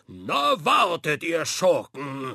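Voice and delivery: loud, slow pirate battle cry; a pirate charging into the waves; joyful shout. A narrator's reading. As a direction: shout with glee at the rogues. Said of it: "schrie voller Freude".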